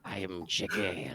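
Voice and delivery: slow, low-key menacing werewolf; spooky shadow werewolf voice. Acted. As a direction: menace them slowly from the shadows. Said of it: "my spooky shadow werewolf voice".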